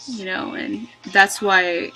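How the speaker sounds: usual speaking voice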